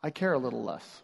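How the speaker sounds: mild apathy. Acted. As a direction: let it drop, as if interest is slipping. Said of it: "It's saying".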